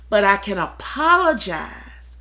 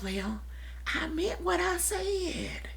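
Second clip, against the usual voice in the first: much softer, slower, stubborn; firm quiet insistence; 9 semitones higher, 12 dB quieter than usual. Retold or imitated. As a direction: hold your ground softly. Standing firm on her words.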